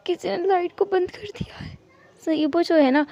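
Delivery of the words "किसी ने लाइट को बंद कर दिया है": frightened hushed whisper; fearful whisper in the dark; scared whisper. Acted. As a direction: whisper as if someone might hear. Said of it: "whisper करते हुए बात कर रहा था".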